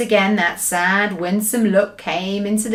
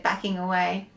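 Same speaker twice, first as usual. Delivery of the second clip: plain reading voice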